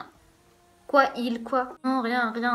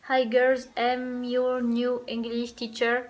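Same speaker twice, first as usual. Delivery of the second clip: put-on slow English with a French accent; much slower than usual. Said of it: "alors bonjour mon accent". (anglais avec accent français, très lent)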